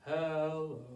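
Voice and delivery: low, drawn-out hello; as low as possible. Shown as a demonstration. Librarian showing toddlers the lowest hello, low. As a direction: drop to the bottom of your range. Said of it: "as low as we can".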